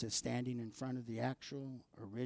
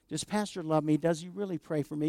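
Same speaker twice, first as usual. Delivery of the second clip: higher and louder, as the listener asking; louder, pleading; 4 semitones higher, 6 dB louder than usual. Shown as a demonstration. Preacher voices the congregation's question, louder and pleading. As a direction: ask it out loud with urgency. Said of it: "You gotta answer that for yourself".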